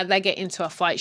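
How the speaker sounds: ordinary talk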